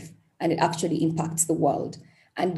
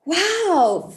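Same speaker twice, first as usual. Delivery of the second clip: moved wow; 12 semitones higher, 5 dB louder than usual. Real, not acted. Moved by a deep question.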